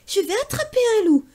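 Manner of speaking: put-on high, fast excited child; a boy bursting with a plan; eager boy. Pierre dreams of catching a wolf, high and fast.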